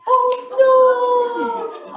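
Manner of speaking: loud, high oh no; mock dismay. Singer crying out at being eaten. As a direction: cry out in playful shock.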